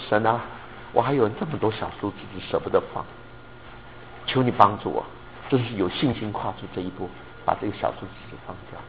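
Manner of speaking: hushed prayer. Shown as a demonstration. Modelling a prayer.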